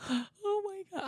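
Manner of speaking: high, hushed gasp, imitating tears; retold tearful gasp. Friend retelling reading the mom's text, imitated tearful gasp. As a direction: re-enact a soft, gasping sob of a reaction. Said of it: "I started hysterically crying".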